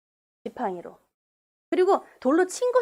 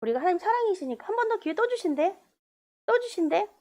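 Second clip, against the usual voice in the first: higher, flippant singsong; mocking presumption; 6 semitones higher than usual. Preacher mocks careless believers, flippant. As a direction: smugly take God's grace for granted. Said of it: "만홀히 여김을 받지 않으신다".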